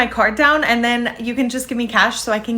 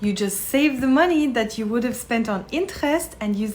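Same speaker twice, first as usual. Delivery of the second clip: put-on French accent. Frenchwoman dismissing credit cards to tourist, French accent. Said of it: "a very impressive French accent".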